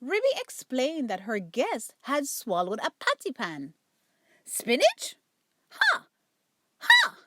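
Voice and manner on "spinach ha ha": squawking bird cry leaping very high; magpie squawk. Acted. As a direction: caw it like a cocky magpie. Magpie doctor's cackling cry.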